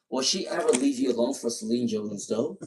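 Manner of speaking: ordinary talk, nothing marked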